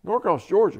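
put-on loud, high echo in a Southern accent; strong Southern accent. Commander repeating the lieutenant's hometown, Southern accent. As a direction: throw it back as a Southern commander. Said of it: "in a real strong Southern accent".

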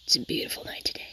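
mock guided meditation. Gamer to her viewers during a quiet build, mock meditation whisper. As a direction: lull the viewers into fake calm, slowly.

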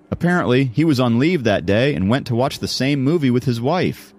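lively confiding schoolboy; Jacob, telling a tale. Acted. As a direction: build up the anecdote with a lifted voice. Jacob finishes his school story.

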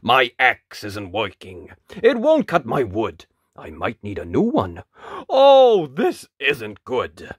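lightly voiced grumbling woodcutter; a worried woodsman, lightly voiced. Woodcutter character voice.

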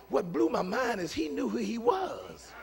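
usual speaking voice

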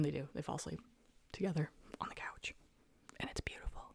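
breathy hushed ASMR whisper; soft unvoiced ASMR murmur over the sleeping couple. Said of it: "A S M R".

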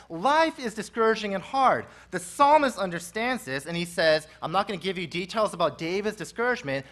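normal talking voice

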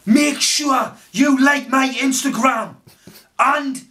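high, loud and slow sign-off; hammed-up outro yell; loud hype shout. Shown as a demonstration. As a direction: shout it out like a hyped YouTuber. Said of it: "I'll do it like this".

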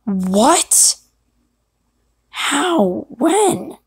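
high, swooping startled questions, voice cracking; young apprentice squeaking in disbelief. Acted. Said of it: "that one has a voice crack".

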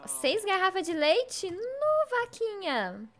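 high-pitched amazed exclamation. Real, not acted. Streamer reacts to the cow's six bottles of milk, amazed. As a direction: marvel at it, pitch shooting up.

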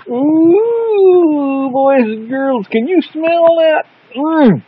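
put-on high, slow sing-song to children; kids show host.

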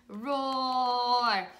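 firm, held mezzo forte roar; medium-loud roar. Shown as a demonstration. Teacher recaps the medium dinosaur, medium-loud roar. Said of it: "speaking voice for our medium dinosaur".

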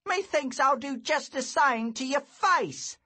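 put-on high, loud menacing boy; a bully sneering a threat; threatening bully. A narrator's reading.

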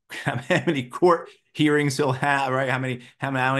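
amused chuckle about court hearings; laughing. Real, not acted. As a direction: laugh it out in disbelief. Laughing at Trump's legal troubles.

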